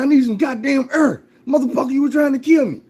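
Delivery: high, loud, furious yell in a put-on voice; the half-drowned man yells at the mentor, outraged; frantic outrage. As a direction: scream it, indignant and panicked.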